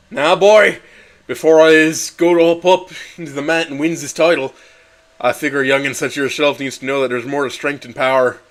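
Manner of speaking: a hint of an Irish dad talking to his boy; Irish accent. Irish father advising his little son before the fight, light Irish accent. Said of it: "I wrote it in the accent".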